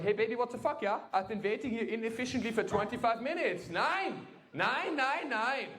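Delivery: hammed-up high-pitched German scolding; mock mad German. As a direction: parody a fussy German losing his temper. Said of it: "I got a little mad at her".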